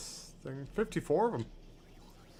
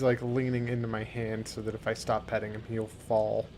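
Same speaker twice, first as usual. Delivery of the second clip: ordinary talk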